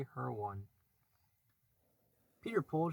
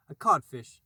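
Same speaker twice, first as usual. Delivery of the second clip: taunting; 11 dB louder than usual. Acted. Peter taunts Hook.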